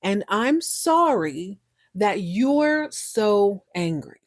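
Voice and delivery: very slow, drawn-out quote; slowly relayed apology; retold apology. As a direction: stretch "sorry" and "so", trail off on "angry". Quotes the apology that angers her.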